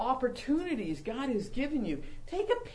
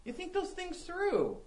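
ordinary delivery

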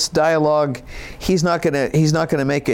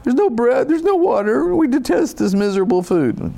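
high, nasal-free whiny complaint; mock whining voice; whining Israelites; 6 semitones higher than usual. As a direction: whine like ungrateful people. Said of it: "Can't you just hear him whining?".

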